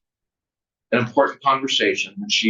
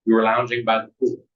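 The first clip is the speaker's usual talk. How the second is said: usual speaking voice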